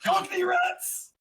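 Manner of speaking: high, drawn-out delighted call; gleeful cry. Real, not acted. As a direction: cheer the idea of Cockney rats. Player delights in rat voices.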